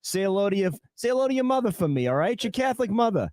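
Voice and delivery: Boston tough guy, high and cocky; Mark Wahlberg Boston accent. Pretend. Host asks for his Wahlberg bit, hammed-up high Boston accent. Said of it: "What about you, [name]?".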